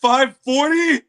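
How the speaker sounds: high, loud and slow disbelief; can't believe the number; incredulous. Real, not acted. Reading the trailer on stream, high loud disbelief.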